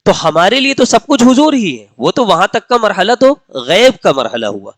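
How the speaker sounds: ordinary talk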